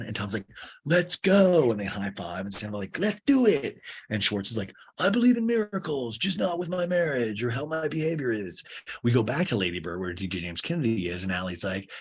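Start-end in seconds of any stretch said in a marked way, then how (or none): 0.8-1.7 high, loud rallying cry; mock hype yell. Bar owner before a high five, mock pumped-up. Tom hyping up, acted. 3.0-3.8 high, loud answering cheer; mock hype yell. Bar owner answering a high five, mock pumped-up. Sandoval hyping up, acted. 5.0-8.6 mock wistful reality star, pitched high; high start, sliding gradually down; Schwartz. Husband admitting his marriage is past saving, mock wistful.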